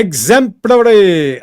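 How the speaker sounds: slow, loud, high "Exemplary"; thrilled word find; excited. Real, not acted. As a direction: savour "Exemplary" as a triumph. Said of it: "Oh my God".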